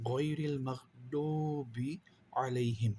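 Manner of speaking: slow Quranic chant; drawn-out recitation. Shown as a demonstration. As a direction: stretch the word as in recitation. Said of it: "teacher demonstrates recitation". (ধীর তিলাওয়াতের সুর)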